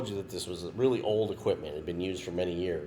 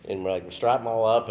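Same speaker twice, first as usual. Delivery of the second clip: ordinary talk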